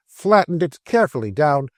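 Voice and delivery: ordinary delivery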